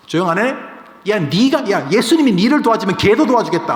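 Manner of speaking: loud, high mocking rebuke; scornful jeering. Crowd hushes and sneers at the one calling Jesus, jeering. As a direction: jeer at them and shout them down.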